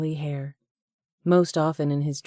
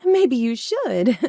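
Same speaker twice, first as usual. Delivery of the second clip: higher, louder, slow smug reply; smug agreement; 8 semitones higher, 7 dB louder, slower than usual. A narrator's reading. Flirt smugly agrees to be left alone with him. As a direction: draw it out, pleased with herself.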